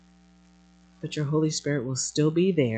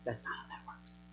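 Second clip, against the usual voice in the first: hushed, lower whisper; whispered aside; 6 semitones lower, 18 dB quieter than usual. Real, not acted. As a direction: repeat it under your breath. Hushed aside repeating the correction.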